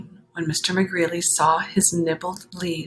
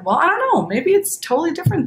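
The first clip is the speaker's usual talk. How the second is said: surprised doubt; 11 semitones higher, 5 dB louder, faster than usual. Real, not acted. Sudden doubtful aside.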